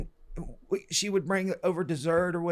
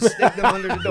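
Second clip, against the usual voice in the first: laughing; 4 semitones higher, 9 dB louder than usual. Retold or imitated. Retelling joke, laughing through quote.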